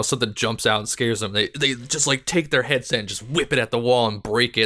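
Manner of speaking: ordinary talk, nothing marked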